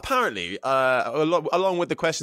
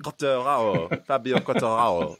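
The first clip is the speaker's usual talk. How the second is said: French accent. Shown as a demonstration. Host jokes about his pronunciation, then says the name in a French accent. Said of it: "with a proper French accent".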